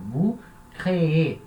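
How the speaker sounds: slow "créez" with both syllables, cré-É; strong last syllable. Shown as a demonstration. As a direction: stretch "créez" to sound its final É. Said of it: "tem que falar ela".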